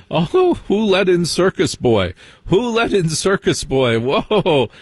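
high, hammed-up snobbish alarm; mock horrified crowd. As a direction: squeal in mock horror at the outsider. Crowd horrified by Circus Boy.

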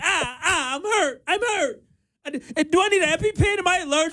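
very high, fast panicky whimper; hammed-up wounded crybaby; whiny imitation. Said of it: "cussing on air".

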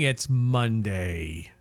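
low, slow, gloomy doom voice. Acted. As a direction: drag it out like doomsday. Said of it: "Why'd you say that in a doom voice?".

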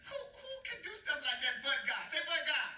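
high, wailing burst of praise; exultant preacher's cry. Real, not acted. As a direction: cry it out to rouse the congregation. Preacher exclaiming over the verse.

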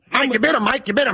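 put-on boxer's slur, urgent; Rocky. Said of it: "do your Rocky impersonation".

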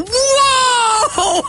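high, shocked whoa; onlookers' high shocked whoa. Retold or imitated.

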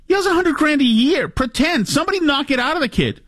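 high-pitched incredulous rant; worked-up, high exasperation; exasperated. Real, not acted. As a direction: plead in disbelief, voice pushed way up. Exasperated rant about Yale student.

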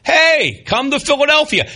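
high-pitched, mock-cheerful hostage plea; imitating a smiling hostage-video appeal; hostage with a smile. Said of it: "like a hostage video with a smile".

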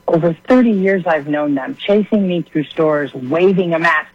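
normal talking voice

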